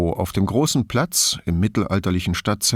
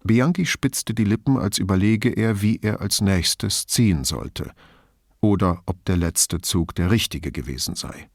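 ordinary delivery, nothing marked

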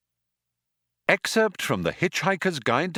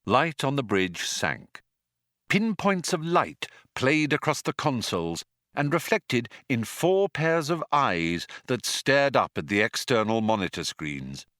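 usual speaking voice

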